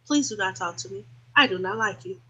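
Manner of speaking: low, soft, measured refusal; cool, controlled dismissal; demonstratively calm. Shown as a demonstration. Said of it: "no problem being calm".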